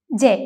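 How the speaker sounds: "ജെ" with the j sound of jam; Turkish letter sound. Shown as a demonstration. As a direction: say "ജെ" as je, not an English c. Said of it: "എന്നാണ് ഉച്ചരിക്കേണ്ടത്".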